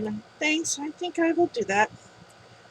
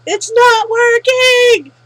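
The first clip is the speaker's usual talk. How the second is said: higher, louder, slow drawn-out wail; frustrated outcry; 8 semitones higher, 11 dB louder, much slower than usual. Acted. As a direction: wail it like nothing works. Jin complains his charm fails.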